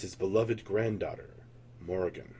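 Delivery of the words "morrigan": plain reading voice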